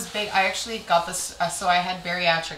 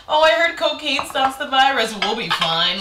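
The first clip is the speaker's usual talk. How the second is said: high, loud mock know-it-all voice; mocking dismissal; 7 semitones higher, 7 dB louder than usual. Resort staff laughing off the virus, mocking and carefree. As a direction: brush it off loudly, starting high. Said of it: "We were laughing at everyone".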